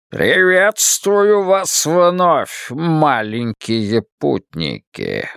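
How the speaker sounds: slow, grand wizard voice; booming Oz voice. Acted. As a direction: boom it out slowly like a wizard. Oz voice from cylinders.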